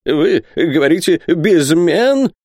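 put-on high, halting old man's question; horrified. A narrator's reading. Isaac asked to lend a steelyard, horrified. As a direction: stammer it out, climbing in disbelief. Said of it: "воскликнул Исаак в ужасе".

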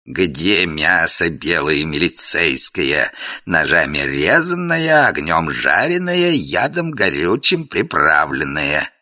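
very slow, drawn-out villain voice; Koschei voice. Acted. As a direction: relish every word like a sinister king. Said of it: "начал Кощей". (медленный голос Кощея, смакуя)

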